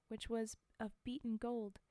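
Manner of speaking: plain reading voice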